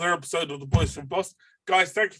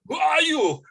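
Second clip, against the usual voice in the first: booming Schwarzenegger-style demand, much higher and louder; Arnie voice; 5 dB louder than usual. Retold or imitated. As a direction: bark it like an action hero. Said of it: "Like Arnie".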